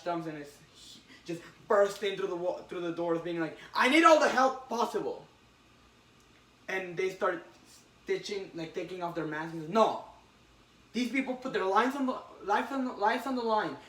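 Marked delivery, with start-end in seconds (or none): 3.7-5.2 loud, high, urgent call for help; a hammed-up emergency shout. Flash bursting in for help. 9.7-13.8 insistent yell. Retold or imitated. Flash protecting heroes' masks.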